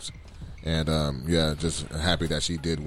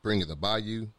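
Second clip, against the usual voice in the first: ad read; 3 semitones higher, 5 dB quieter than usual. Acted. Reads a sponsor ad.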